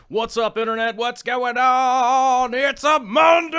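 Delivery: high, loud hyped-up greeting; fired-up stream-opening holler; excited yelling. Real, not acted. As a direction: yell it out, building to a shout on the last word. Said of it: "Why am I yelling?".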